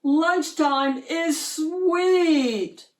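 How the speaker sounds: high, slow gleeful yell. A narrator's reading. Shark pupil at lunch, yelling with glee. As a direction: yell it like the best news ever. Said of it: "yelled Clark the shark".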